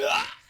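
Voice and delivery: very high-pitched mock shriek; imitated scream. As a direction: scream back in comic fright. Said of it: "So I scream".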